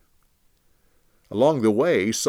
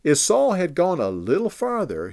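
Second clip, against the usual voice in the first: ordinary delivery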